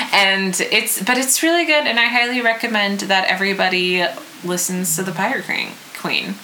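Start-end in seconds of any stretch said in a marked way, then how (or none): none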